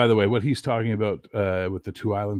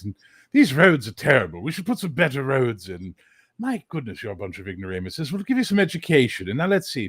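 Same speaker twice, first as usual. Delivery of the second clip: higher, genteel British colonizer voice; 4 semitones higher than usual. Retold or imitated. Teacher mocking British colonizers to students, higher, genteel voice. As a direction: play a fussy, self-satisfied British colonial officer. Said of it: "The British showed up and went".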